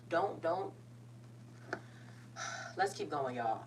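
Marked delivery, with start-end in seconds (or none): 1.1-2.7 long breathy exhale to steady oneself; composing sigh; heavy sigh. Real, not acted. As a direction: let the emotion out in a sigh. Pausing to avoid crying.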